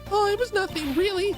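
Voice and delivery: put-on bright high-pitched young woman; high girly voice. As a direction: squeak it out, eager and star-struck. Same girl character continues.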